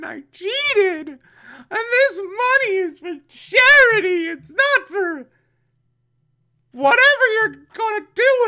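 high wailing sob-talk, very high and loud; hammed-up crying beauty queen; mock crying. Beauty queen confesses to Penguin, wailing through mock sobs. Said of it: "is crying her eyes out".

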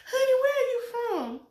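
high, syrupy "Honey, where are you from"; mock sweet city lady. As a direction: play the teasing outsider, sing-song. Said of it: "as soon as I say something".

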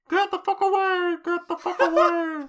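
very high, loud yelled alarm; warning shout. Pretend. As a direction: scream it like a siren warning whales off. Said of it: "which is like".

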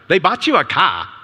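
lightly imitating a Ghanaian accent; a hint of a Ghana accent, blunt and to the point; mild Ghanaian accent, matter-of-fact. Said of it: "in this Ghana accent".

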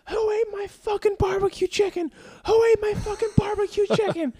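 put-on drunken yell. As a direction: holler it like a furious drunk roommate at 2 a.m. Drunk roommate yelling at night.

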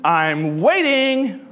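slowly drawn out, mock impatient; impatient waiting. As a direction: drag it out like you're tapping your foot. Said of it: "You say".

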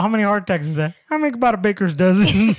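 high, loud mock character voice; hammed-up Bears fan bluster; Farley's heart-attack skit. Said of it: "He's all".

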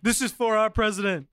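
high, badly faked French accent; hammed-up mock-French line; French accent attempt. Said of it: "What was that accent?".

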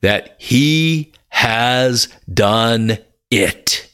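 very slow, each word weighed; slow and deliberate. Shown as a demonstration. Preacher revealing the psalm's last line, slow and deliberate. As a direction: draw it out word by word. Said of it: "Watch this".

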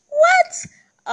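squealed high astonished what; a sudden shriek of amazement; amazed squeal at listeners in Uganda. Real, not acted.

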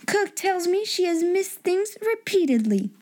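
high stern scolding voice; a cold headmistress scolding a maid. A narrator's reading. Miss Minchin scolds Becky.

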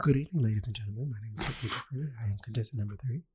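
soft, quick beauty-queen introduction; mock pageant contestant. Teasing her cohost with a soft mock pageant introduction. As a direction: murmur it like a contestant's rehearsed intro.

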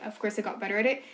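ordinary delivery

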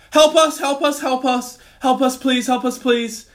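high, loud, near-tearful cries for help; terrified plea. Retold or imitated. Imitating trapped girls on the radio, terrified and near tears. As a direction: beg for help as if trapped and crying. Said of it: "terrified, like, nearly crying voice that you put on".

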